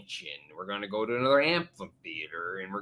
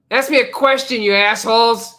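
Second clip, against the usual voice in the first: loud, high holler for questions; mock-rude yell; 12 semitones higher, 12 dB louder than usual. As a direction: yell it at the audience in mock abuse. Calls for audience questions.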